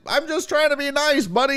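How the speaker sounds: high mock-innocent whine; chatty guy voice. Imitating the chatty customer answering back, mock whine. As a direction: play the hurt nice guy. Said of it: "And the guy's like".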